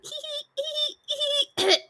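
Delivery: a playful high hee-hee of a happy dragon; cheery dragon giggle. Retold or imitated. Teasing her friend as a cheery dragon, high giggle. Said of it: "cheery, happy dragon that's like".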